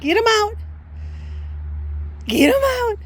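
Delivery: high excited encouragement; high pet-talk cheer. Real, not acted. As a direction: cheer her zoomies on.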